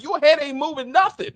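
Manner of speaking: high, loud incredulous outburst; mock disbelief. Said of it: "'cause I'm like".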